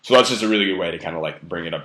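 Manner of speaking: usual speaking voice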